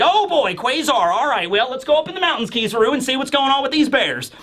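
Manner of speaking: exclaiming, sing-song put-on voice; Vegapunk. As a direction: play an eager scientist, pitched well up. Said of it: "And so Vegapunk's like".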